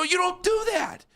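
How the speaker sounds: re-yell the warning at him; retold shout. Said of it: "I go".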